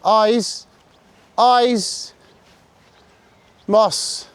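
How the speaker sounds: very high, loud shout; sharp high call across the park; shouted recall. Real, not acted. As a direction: yell to get his eyes.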